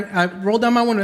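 usual speaking voice

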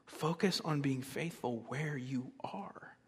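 slow, very soft delivery; hushed restatement. Real, not acted. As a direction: drop the volume and let each word settle. Softly restating the point as answer.

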